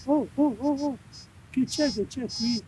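high, eager oh oh; thrilled at the find; excited discovery. Real, not acted. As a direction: jump up at the signal. Detector beeps, finds something.

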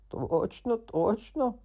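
high rising questions; not quite believe it; doubtful frog. Acted. Frog doubts the cat, high and rising. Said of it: "недовірливо перепитав".

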